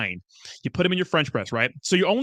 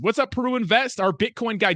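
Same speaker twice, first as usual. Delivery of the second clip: excited greeting; 7 semitones higher than usual. Real, not acted. Greeting a chatter by name.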